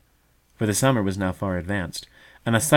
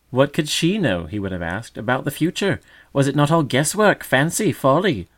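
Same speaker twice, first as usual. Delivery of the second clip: higher, louder scoffing; mocking magpie; 6 semitones higher, 4 dB louder than usual. A narrator's reading. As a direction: sneer at her foolish hope. Imagined magpie mocks.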